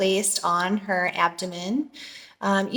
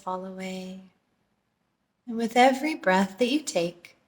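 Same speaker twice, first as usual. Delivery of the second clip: calm meditation voice, softening as it goes; guided meditation; 4 dB quieter than usual. Real, not acted. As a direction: ease them into stillness, with long pauses between phrases. Leads a meditation.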